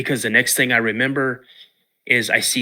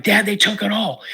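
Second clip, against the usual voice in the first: put-on much higher, urgent child voice; boy telling his dad the stuff is gone, high and urgent; excited kid; 9 semitones higher than usual. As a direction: play the frantic twelve-year-old. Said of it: "and so I'm like".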